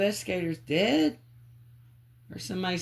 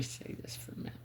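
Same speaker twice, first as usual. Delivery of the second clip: whispered aside; 14 dB quieter, much faster than usual. Real, not acted. Aside during spirit session.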